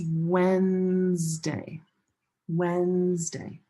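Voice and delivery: Wednesday stretched out as WENNNZ-day; slow two-syllable Wednesday with a long first syllable, no D before the N; silent D and E. Shown as a demonstration. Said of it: "the E here are silent".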